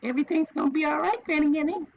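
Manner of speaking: put-on high, quick toddler voice; little tiny voice. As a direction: sweetly reassure grandma like a little child. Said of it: "I hear her little tiny voice saying".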